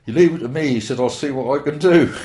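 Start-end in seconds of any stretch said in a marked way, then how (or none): 0.1-0.7 louder, higher, hearty and grand; officer promising to sort it out, hammed-up hearty voice; imitating the careers officer. Officer reassuring the speaker, retold. 1.0-2.1 louder, higher, hearty and grand; officer promising to sort it out, hammed-up hearty voice; imitating the careers officer. Officer reassuring the speaker, retold.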